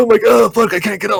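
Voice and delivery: high, loud panicked cry; trapped and frantic. Retold or imitated. Stuck in the bed, high panic. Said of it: "I'm just like gasps".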